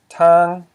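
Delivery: tang said high and loud. Shown as a demonstration. Teacher gives the word for road, tang high. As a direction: hit tang high and strong. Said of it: "With a high tone".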